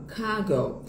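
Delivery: cargo as kah-go with no R; British English pronunciation. Shown as a demonstration. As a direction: drop the R in cargo, British style. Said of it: "Inglês britânico".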